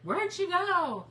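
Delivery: high, slow, loud puzzled voice; mock the confused viewers. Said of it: "you guys are probably like".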